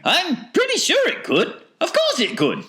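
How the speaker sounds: very high falsetto; piping eccentric voice, sure of itself; confident Wonka. A narrator's reading. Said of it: "heard Mister Wonka saying".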